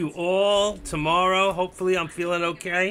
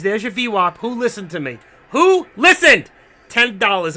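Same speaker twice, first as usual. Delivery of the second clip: excited; 4 semitones higher, 12 dB louder than usual. Real, not acted. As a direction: crow it out, triumphant. Gloating at viewers.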